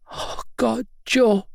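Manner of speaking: put-on tearful girl's cry; fighting back tears; sniffling.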